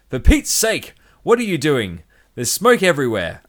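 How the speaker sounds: high, loud angry shout. A narrator's reading. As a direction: shout it at the careless worker. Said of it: "the distillery manager shouted".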